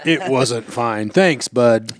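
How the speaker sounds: low, slow deadpan read. Retold or imitated. Cohost reading the text back in slow deadpan. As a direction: drag it out flat and dry.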